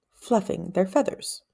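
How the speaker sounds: ordinary delivery, nothing marked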